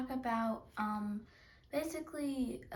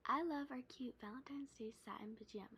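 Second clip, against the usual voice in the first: whisper; 8 dB quieter, faster than usual. Real, not acted. Girl whispers to camera.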